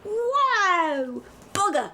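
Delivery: high loud Cockney cry of surprise; Cockney "Bugger!", loud and high; Cockney exclamation. Acted. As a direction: blurt it like a startled Londoner. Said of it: "It's a booger or bugger?".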